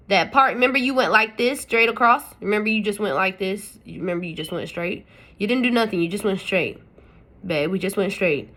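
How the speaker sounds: normal talking voice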